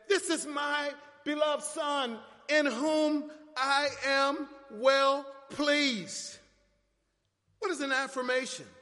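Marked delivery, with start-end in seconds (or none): 0.1-6.4 slow, stately divine proclamation; voice from heaven. Acted. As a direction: proclaim it as God the Father from heaven. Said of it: "a voice came from heaven and said".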